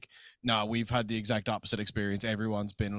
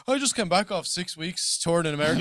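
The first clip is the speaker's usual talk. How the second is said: higher-pitched imitation of a casual brag; mock nonchalance; 6 semitones higher than usual. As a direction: toss it off as no big deal. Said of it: "I don't care how nonchalant you are".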